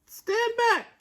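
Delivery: very high pig voice; warning piglet. A narrator's reading. Pete warning his brothers in a high squeak. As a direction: warn them urgently. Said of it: "Pete warned".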